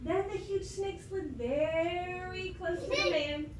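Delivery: ordinary delivery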